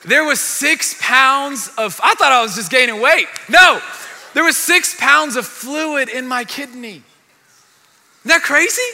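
high, loud and slow, almost shouting; shouting the punchline to the room; excited shout. Real, not acted. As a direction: belt it out to the crowd in disbelief. Shouting the fluid reveal.

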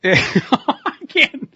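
a real high laugh breaking through; laughing in disbelief. Real, not acted. Host laughing at the croc clip, cracking up. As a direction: laugh until you can't finish.

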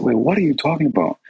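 high-pitched, startled question; startled disbelief. Retold or imitated. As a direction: imitate a dad caught off guard. Dad swerves off at the news.

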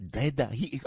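put-on a lightly done Japanese accent on Dead Heat; quick light Japanese-accent imitation of the customs guy; mild Japanese-accent mimicry, rising on Dead; Japanese customs officer accent. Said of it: "He sounded like".

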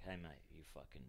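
muttered mock PT. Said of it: "the P T's like".

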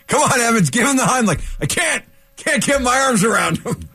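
high-pitched play-acted rescue panic; mock emergency urgency. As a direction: urge a rescue, then protest helplessly, pitched high. Acted panicked Heimlich rescue scene.

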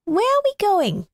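put-on high, curious child voice; little boy voice. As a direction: ask like a wondering small boy.

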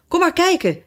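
high, lightly called invitation; calling out. A narrator's reading. Brothers urge Alice to come and look, calling out. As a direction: call someone over, pitched well up. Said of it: "riepen de broers".

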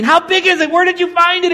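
high, loud, eager questions; excited clamoring. Retold or imitated. Onlookers question the lucky finder, shouting with excitement. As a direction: mimic onlookers calling out in excitement.

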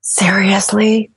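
slow, breathy astonished whisper; stunned hushed seriously; whispered disbelief. Real, not acted. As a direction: barely voice your shock. Reacting to shocking statistic.

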